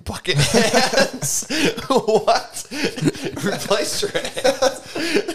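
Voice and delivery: words squeezed out through laughter; laughing hard while talking. Real, not acted. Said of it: "I love it".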